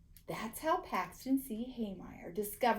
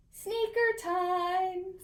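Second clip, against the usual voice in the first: higher, slow enticing call; singsong slow tease; 9 semitones higher, 3 dB louder, much slower than usual. A narrator's reading. Boy tempting his friend with sneakers, slow and high. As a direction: lure her in.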